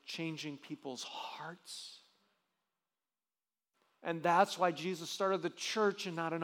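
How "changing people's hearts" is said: hushed whisper. Acted. Preacher to his congregation, whispered slowly for weight. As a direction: drop to a slow breathy whisper. Preacher stressing the key point.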